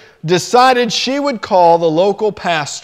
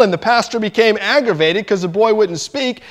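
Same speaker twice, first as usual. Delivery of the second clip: ordinary talk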